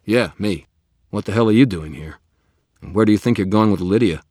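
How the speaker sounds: loud, fast, challenging confrontation; angry. Acted. Wizard confronts vampire.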